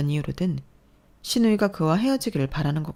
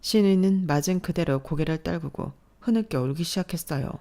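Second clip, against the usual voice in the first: usual speaking voice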